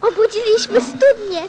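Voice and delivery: put-on eager boy thrilled by the singing well; delighted. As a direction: gush with childlike delight.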